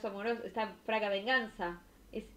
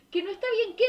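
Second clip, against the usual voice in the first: higher, louder mock outrage; indignant chatter; 10 semitones higher, 6 dB louder, faster than usual. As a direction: fire it back like an outraged friend. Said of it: "dice [name]". (amiga indignada que lee el chat, más aguda y fuerte)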